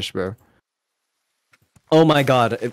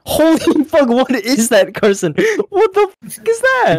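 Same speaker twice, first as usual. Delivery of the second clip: high, loud shocked yelling with a laugh; laugh-yell in disbelief at the team; stunned outburst; 13 semitones higher, 7 dB louder than usual. Real, not acted.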